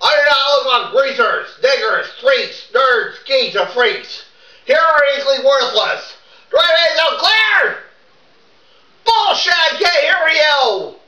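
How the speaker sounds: full-voice boot camp shouting, rehearsed; rehearsed drill-sergeant yelling. Pretend. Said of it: "you don't have to yell it".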